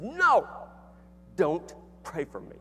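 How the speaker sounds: furious shout. Retold or imitated. Mad professor shouting at him, high and loud. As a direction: jab the finger and yell it. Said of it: "really made him mad".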